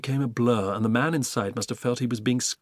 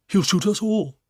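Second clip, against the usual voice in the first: higher, louder alarmed giraffe; alarmed warning; 8 semitones higher, 6 dB louder than usual. Acted. Giraffe warning the Duke, alarmed. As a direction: warn them urgently.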